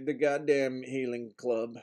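normal talking voice

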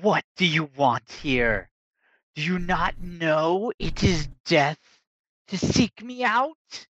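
menacing ghost, rising high; dark lord. Acted. Ghost elf threatens the party.

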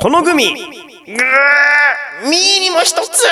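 high shouted pun with a long drawn-out "guu"; hammed-up pun. Shown as a demonstration. Host performs listener Judy's gummy pun, hammed-up high shout. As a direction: ham it up high, stretching the middle right out.

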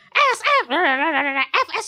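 high, loud burst of garbled cursing; mock swearing. Church speaker mimics a tough grandma, mock swearing. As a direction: imitate a grandma's sweary rant. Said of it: "and then she swears a lot".

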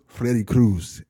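low, slow late-night radio host; Freddie Cruz. Acted. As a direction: drop deep and stretch the words out. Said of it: "he talked just like that".

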